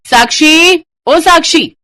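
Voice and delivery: loud, high call across the house. Acted. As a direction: shout for her from afar. Babita calls out across house. (ज़ोर से पुकारना)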